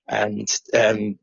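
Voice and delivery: ordinary talk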